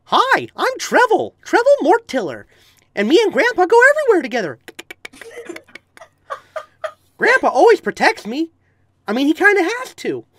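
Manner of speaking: put-on very high little-kid voice; high-pitched child necromancer, eagerly introducing himself; Trevor Mortiller. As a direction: pipe up like an innocent ten-year-old. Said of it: "I played a ten-year-old necromancer".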